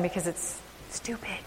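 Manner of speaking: hushed, almost voiceless; preacher confiding to the congregation, whispered; whispered aside. Real, not acted. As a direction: whisper it as a confession.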